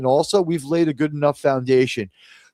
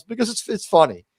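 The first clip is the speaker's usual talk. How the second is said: usual speaking voice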